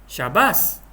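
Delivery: pleased "well done"; demonstrated cheerful exclamation; happy praise. Shown as a demonstration. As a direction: congratulate someone gladly. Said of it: "sebab kita nak menggambarkan perasaan gembira".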